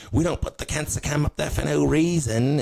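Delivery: high, insistent mock voice; put-on band frontman. Band defending its cancer cam, high comic insistence.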